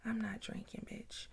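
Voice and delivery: hushed mock whisper to self; under-breath suspicious whisper; whispered aside. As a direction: whisper it like you're sneaking a thought. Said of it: "I'm like".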